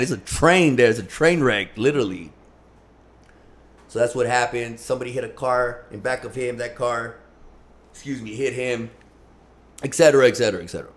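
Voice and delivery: ordinary talk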